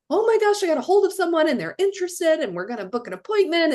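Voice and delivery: high, breathless-with-news rush; excited caller. Retold or imitated. Caller bursting to report a lead to the team, excited. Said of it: "our callers get so excited".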